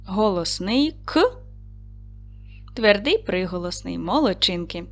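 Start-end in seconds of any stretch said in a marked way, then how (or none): 1.0-1.3 "К" as one short hard consonant; quick hard "К" on its own; isolated sound. Shown as a demonstration. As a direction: sound out "К", not the letter name. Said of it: "виконаємо звуковий аналіз слова".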